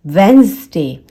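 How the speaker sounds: वेडनेसडे as "WENZ-day"; careful model "wenz-day" for वेडनेसडे; silent D. Shown as a demonstration. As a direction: say वेडनेसडे in two syllables, no first D. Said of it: "ये होता है वेडनेसडे".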